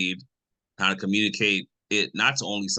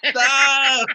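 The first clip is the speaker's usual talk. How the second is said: laughing stop; 9 dB louder than usual. Real, not acted. Reacting to the joke.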